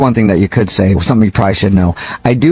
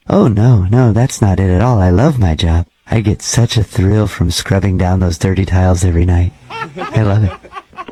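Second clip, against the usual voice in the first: measured, slightly lowered janitor voice; lightly voiced creepy janitor; 4 semitones lower than usual. Night janitor cold-calling a gym member, lightly creepy voice. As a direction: play a polite, oddly intimate night janitor, understated. Janitor relishes scrubbing tiles.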